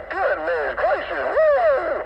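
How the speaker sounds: slow, drawn-out "Goodness gracious" then a high whoop; amazed. Real, not acted. Marveling at the controller's slow key, drawn out then whooping. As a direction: savor it slowly, then whoop.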